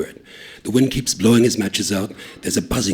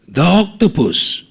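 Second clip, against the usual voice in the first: dramatic reveal; 9 semitones higher, 8 dB louder, slower than usual. A narrator's reading.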